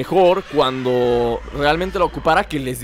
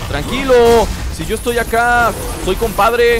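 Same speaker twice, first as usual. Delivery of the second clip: higher-pitched, pleading reassurance; playful placating; 6 semitones higher than usual. Pretend. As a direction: talk the beasts down like a buddy. Playfully calming the game's monsters. (apaciguando en broma)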